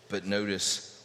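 usual speaking voice

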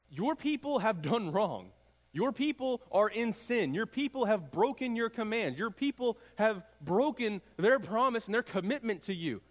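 preacher voicing Moses fervently; impassioned plea to God. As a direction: press each charge as Moses before God. Moses confessing Israel's sin to God.

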